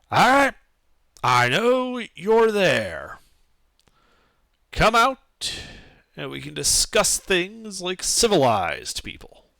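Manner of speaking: loud gruff call through a door; dwarf guard calling out. Acted. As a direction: call out to intruders behind the door. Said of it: "you hear him call out".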